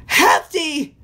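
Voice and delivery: high-pitched cry of dismay; high, anguished shout; distressed cry. A narrator's reading. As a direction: call out to a stricken friend. Smurfette cries to frozen friend.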